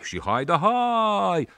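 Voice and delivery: high, slow, drawn-out cry; long raftsman's whistle-call; boy imitating the helmsman's call for the policeman; rafter's call. Shown as a demonstration. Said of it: "fluieră iară. Lung".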